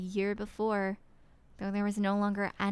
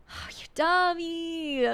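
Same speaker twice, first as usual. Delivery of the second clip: higher, drawn-out, aching cry of oh, you idiot; real heartbroken groan at the character; exasperated anguish; 9 semitones higher, 6 dB louder, slower than usual. Real, not acted. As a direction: scold a fool you pity, near tears. Reader upset at character.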